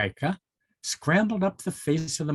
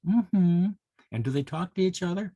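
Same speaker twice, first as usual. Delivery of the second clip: plain reading voice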